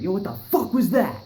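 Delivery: high, loud challenging demand; voicing the armed neighbor, loud and high; suspicious neighbor. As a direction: call out like a guy who just ran outside. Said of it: "He's like".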